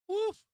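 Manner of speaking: high, loud woof at the chart; a sudden high yelp of shock; startled woof. Real, not acted. Trader yelps at the chart dropping, a loud high woof. Reacting to a sharp market drop.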